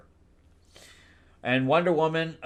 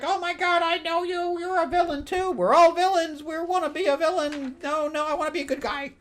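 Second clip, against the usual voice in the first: much higher, squeaky cartoon villain; silly villain voice. Villain gushing that he's recognised in a high, goofy voice. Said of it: "And he's like".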